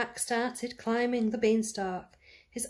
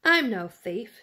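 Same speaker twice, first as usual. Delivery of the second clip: louder, firm denial; indignant protest; 7 dB louder than usual. A narrator's reading. Jack denying he is a thief to the giantess, loud. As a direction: push back hard against the accusation.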